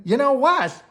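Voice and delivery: loud, high call; yelled. A narrator's reading. Gwen calling back to her friend, loud and high. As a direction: shout it out to Jill. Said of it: "yelled Gwen".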